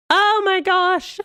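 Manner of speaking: high, slow swoon of delight; swooning gush. Real, not acted. Fan gushing to viewers about Richard, high and slow. Swooning over a book character.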